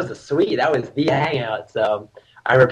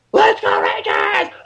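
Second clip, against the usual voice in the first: retold shouted cheer; 4 semitones lower, slower than usual. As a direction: yell it like a crowd cheer. Re-enacting the cheer from graduation.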